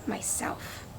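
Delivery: whispered last word; a hen whispering, pleased with herself. A narrator's reading. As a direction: breathe it out softly. Hen ends story slyly.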